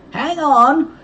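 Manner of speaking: high, loud warning shout; urgent yell, pitched right up. A narrator's reading. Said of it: "Tex shouted".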